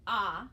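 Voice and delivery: "ah" as in hot, open and loud; short o sound. Shown as a demonstration. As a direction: say "ah" with the jaw dropped. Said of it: "ah sound".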